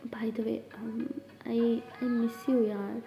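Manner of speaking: softly whispered, shy admission; hushed confession. Acted. Sara whispers to Jas that she misses her. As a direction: breathe it out like a secret. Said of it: "हंसते हुए कहती".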